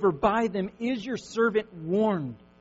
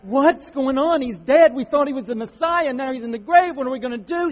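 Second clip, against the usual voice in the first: louder, higher alarmed cry; panicked disciples; 5 semitones higher, 8 dB louder than usual. Retold or imitated. Disciples grieving at Christ's death, loud panicked cry. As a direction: voice the followers' despair at the grave. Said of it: "everybody's like".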